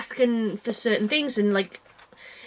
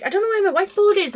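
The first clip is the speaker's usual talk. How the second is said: higher, quicker flustered panic; flustered tizzy; 9 semitones higher, 3 dB louder, faster than usual. Retold or imitated. Retelling her panic over the lost whiteboard, flustered. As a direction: fret like you've mislaid something important. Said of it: "I had a bit of a, a tizzy about it".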